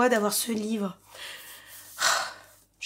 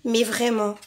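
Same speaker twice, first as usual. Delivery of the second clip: ordinary talk, nothing marked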